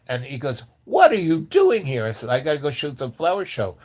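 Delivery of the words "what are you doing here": loud, high-pitched incredulous question; flabbergasted. Retold or imitated. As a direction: imitate a stunned cop demanding an explanation. Retold cop confronts tripping friend.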